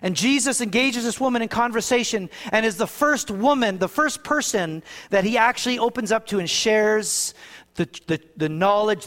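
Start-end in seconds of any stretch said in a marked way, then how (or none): none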